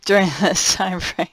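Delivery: amused laugh leading into the words. Real, not acted. Reader amused by a colder forecast in summertime, laughing. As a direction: say it through a real chuckle. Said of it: "I'm just laughing because".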